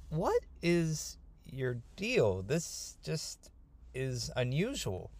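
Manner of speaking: slow, hushed suspicion; very suspicious. Acted. Yaakov questioning the man with endless camels, suspicious. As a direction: draw it out softly, baffled and wary. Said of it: "Yaakov is getting very suspicious".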